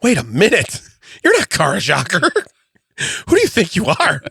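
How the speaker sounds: high, laughing outraged owner. Pretend. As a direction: squeal it like the cheated car owner. Mock outraged car owner.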